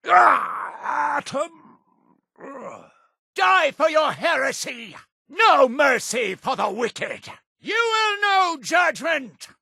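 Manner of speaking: high-pitched zealot's war shout; battle cry. Acted. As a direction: shout condemnation at the heretics. Battle cries at enemies.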